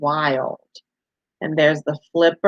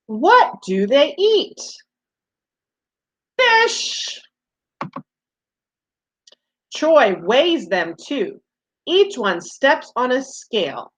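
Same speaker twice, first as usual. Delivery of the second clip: put-on reading to children; 3 semitones higher than usual. Reads picture book to children.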